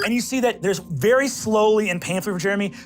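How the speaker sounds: ordinary delivery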